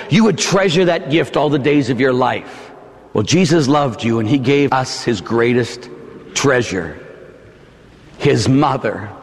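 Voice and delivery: ordinary delivery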